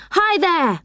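high call to the driver. A narrator's reading. Joe hailing the train driver, high call. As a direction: hail him from down the platform. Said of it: "yelled Joe to the driver".

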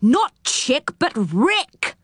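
understated storybook shout; mild shout. A narrator's reading. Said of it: "shouted Moonface".

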